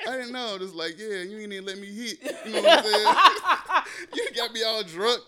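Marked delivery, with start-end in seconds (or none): 0.1-0.6 put-on high, apologetic girl. 1.0-2.2 laughing. Real, not acted. Laughing complaint. 2.5-5.2 high, loud laughing words; cracking up mid-sentence; laughing hard. Real, not acted.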